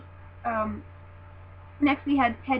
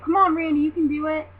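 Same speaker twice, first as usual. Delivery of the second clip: higher, louder rooting for a favorite; re-enacted encouraging cheer; 5 semitones higher, 7 dB louder than usual. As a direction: call it out like an eager fan.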